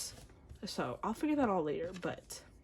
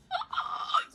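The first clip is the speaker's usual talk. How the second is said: high, sharp gasp; shocked fangirl gasp; 4 dB louder than usual. Real, not acted. Fan seeing a favorite in the photo book, shocked gasp. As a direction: gasp shrilly at the sight.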